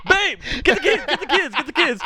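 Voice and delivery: hammed-up alarm, rushing the family; mock panicked dad. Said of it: "And he's like".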